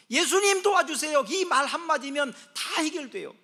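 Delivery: loud, high cry to Jesus; acted urgent plea. Preacher voicing a dreamer's call to Jesus, loud and urgent. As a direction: preach it out at full voice.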